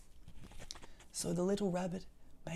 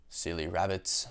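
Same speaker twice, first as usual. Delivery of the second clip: lower, scolding owl; 9 semitones lower than usual. Acted. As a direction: tut at the rabbit. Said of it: "said the owl".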